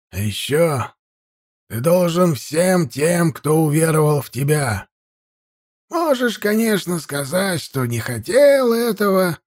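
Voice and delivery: lightly voiced wise old man; old priest voice. Old priest lectures Ayur.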